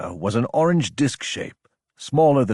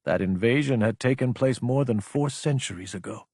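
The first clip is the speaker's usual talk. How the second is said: plain reading voice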